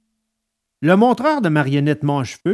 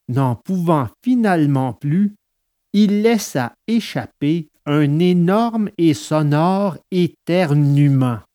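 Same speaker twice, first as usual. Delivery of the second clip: usual speaking voice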